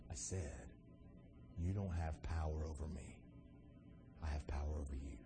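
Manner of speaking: near-whispered, very low assertion; talking real low. Acted. Jesus telling Pilate who has power, talking real low. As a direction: say it so low they must lean in. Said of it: "like Pilate's gotta lean in".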